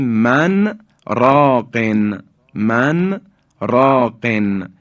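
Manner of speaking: من راقن as man, brief stop, raqin; slow recitation, من and راقن separated; unmerged exception. Shown as a demonstration. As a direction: keep the n in من, then slow راقن. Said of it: "ادغام نمیشه استثناءً".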